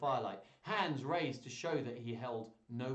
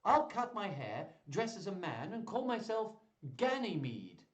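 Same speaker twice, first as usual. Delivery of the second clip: higher-pitched, lightly acted eagerness; mildly excited plan; 7 semitones higher, 4 dB louder than usual. A narrator's reading. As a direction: announce the plan with some relish. Rosalind voiced announcing her plan.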